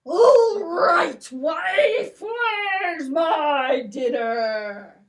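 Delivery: loud shouting giant calling for dinner; gruff giant demanding his dinner; booming giant. Acted. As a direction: bellow like a hungry giant. Giant voiced in story.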